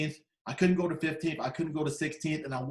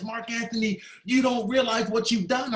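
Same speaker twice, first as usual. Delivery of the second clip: higher, louder retold daughter; emotional insistence; 6 semitones higher, 4 dB louder than usual. Grieving daughter to her mother's visitor, emotional insistence. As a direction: press the point with raised pitch and force.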